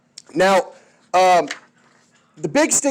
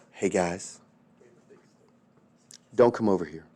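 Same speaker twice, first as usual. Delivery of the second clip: much lower and softer, unhurried warning; calm low warning; 10 semitones lower, 8 dB quieter than usual. Retold or imitated. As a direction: quietly warn them off. Roosevelt's calm quiet warning.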